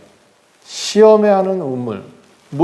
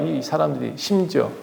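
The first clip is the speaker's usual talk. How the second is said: ordinary delivery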